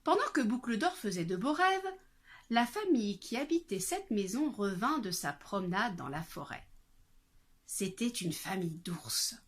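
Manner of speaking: plain reading voice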